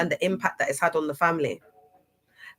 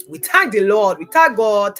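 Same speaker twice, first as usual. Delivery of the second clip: louder, proclaiming thanks to God; loud praise; 8 dB louder than usual. Real, not acted. Streamer loudly thanks God for making it through. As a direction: call out your thanks like a testimony.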